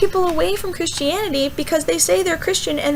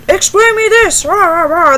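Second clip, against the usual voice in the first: loud, pitched-up angry demand; mock attacking rant; 2 semitones higher, 7 dB louder than usual. Imitating someone attacking you, loud mock anger. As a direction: demand it loudly, then break into ranting noises. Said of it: "they start attacking you".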